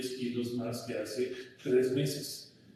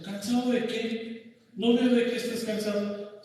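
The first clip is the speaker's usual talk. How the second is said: mocking father; 10 semitones higher, 5 dB louder than usual. Father mocking his tired son's complaint, scornful. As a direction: throw his word back at him in disbelief. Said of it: "Y el papá dice".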